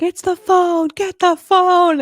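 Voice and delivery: high, loud imitation of frantic characters; hammed-up frantic cry; over-the-top panic. Imitating characters wrecking an office, over-the-top mock panic. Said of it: "like, so over the top".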